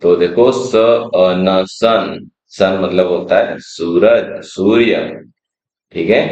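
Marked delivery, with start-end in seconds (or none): 0.5-2.3 स अ न sounded slowly, then joined into सन; slow sounding out. Shown as a demonstration. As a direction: blend स अ न into सन, one sound at a time. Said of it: "S का sound होता है स". (स अ न सन धीरे-धीरे जोड़कर)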